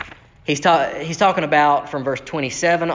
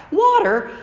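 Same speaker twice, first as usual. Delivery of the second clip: much higher, incredulous rant; exasperated disbelief; 10 semitones higher than usual. Acted. Preacher mocks the grumbling people, higher and incredulous. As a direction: act baffled that anyone doubts God's water.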